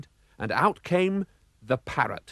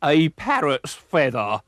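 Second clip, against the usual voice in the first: slow, louder gruff pirate exclamation; pirate finds a clue; pirate's cry; 3 semitones higher, 5 dB louder, slower than usual. A narrator's reading. Bald pirate finds a feather, slow cry. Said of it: "cried Fuzz".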